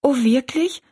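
a high, glad little gasp of a question; girl surprised and relieved; delighted surprise. A narrator's reading. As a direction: lift it up in happy disbelief.

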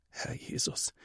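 breathy whisper to God; whispered prayer. A narrator's reading. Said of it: "betet er". (Mathis betet flüsternd zu Jesus)

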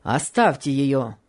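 low, slow commanding man's voice; sharp command. A narrator's reading. St. Clare stamps his foot and orders Rosa to let Topsy be, low and stern. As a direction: cut in low and firm as the master. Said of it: "прервал Сен-Клэр, топнув ногой".

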